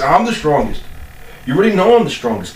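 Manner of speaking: high, fast hammed-up boast; mock boast. Boasting to viewers he's the strongest, hammed-up high brag.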